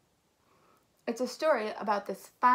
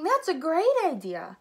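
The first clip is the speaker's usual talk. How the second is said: high, loud delighted cry; raised, swooping excited exclamation; excited shriek; 6 semitones higher, 6 dB louder than usual. Acted. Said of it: "shrieked Nebraska".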